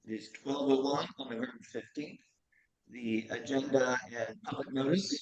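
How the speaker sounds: echoey and far away; big hall echo. Real, not acted. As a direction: voice echoing like in a big empty hall. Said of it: "you sound very echoey and very, very far away".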